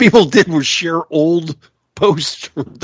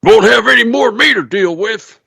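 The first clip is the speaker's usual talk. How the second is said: mock grand flourish; 9 semitones higher, 4 dB louder than usual. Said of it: "do that grand flourish".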